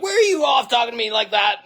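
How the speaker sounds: retold furious shout, pitched high; angry shout. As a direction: bark back like an offended man on the phone. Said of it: "he got angry".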